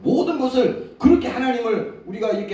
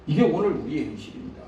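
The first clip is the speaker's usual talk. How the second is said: normal talking voice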